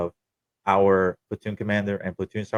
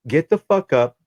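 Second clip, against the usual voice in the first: angry order; 6 semitones higher, 5 dB louder than usual. Retold or imitated. Corporal angrily ordering him out.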